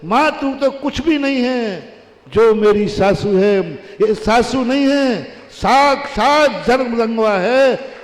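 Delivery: daughter-in-law exalting her mother-in-law, loud and slow; exalted praise. Acted. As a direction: proclaim it with devotion.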